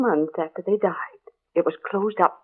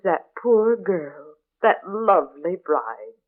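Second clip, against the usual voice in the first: slow, solemn; much slower than usual. Acted. Gossip slowing to savour the tragic tale for the newcomer. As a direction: draw each phrase out slowly. Dramatic slow telling of tragedy.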